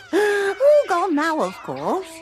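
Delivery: wistful laughing aside to a visitor about dragons. Acted. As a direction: laugh it off fondly, drawing it out.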